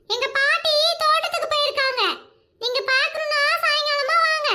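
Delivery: put-on high-pitched small child voice; little granddaughter. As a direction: question strangers as a suspicious little girl. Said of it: "அவளோட பேத்தி". (சின்னப் பேத்தியின் கீச்சுக் குரல்)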